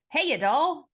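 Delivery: Navy man exclaiming. A narrator's reading. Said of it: "the Navy man exclaimed".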